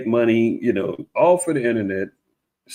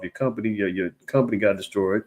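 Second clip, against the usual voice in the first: ordinary talk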